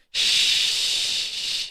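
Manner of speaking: a soft unvoiced shh like background noise; imitated mic hiss. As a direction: breathe out a hissing shh like a hot mic. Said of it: "you're hearing".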